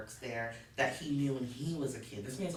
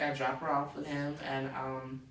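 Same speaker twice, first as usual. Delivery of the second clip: ordinary delivery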